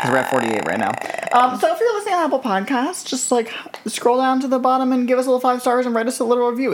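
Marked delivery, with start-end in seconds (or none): 1.3-6.7 lifted, sugary plug voice; high sweet voice. Acted. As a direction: pitch it up sweet and bright.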